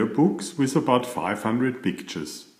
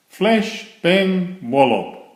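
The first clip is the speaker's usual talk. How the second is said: shouted; 8 semitones higher, 5 dB louder, slower than usual. Acted. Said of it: "shouted Thomas".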